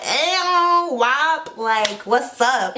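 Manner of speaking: high, casual, slowed "what's up"; lightly mock laid-back greeting; chill indifference. Blind people lounging while everyone dies, mock casual. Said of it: "blind people was just posted up like".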